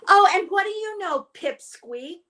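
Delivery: high, fast scornful jeer; scornful sea cow. A narrator's reading. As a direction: sneer down at the little fish. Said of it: "said the sea cow".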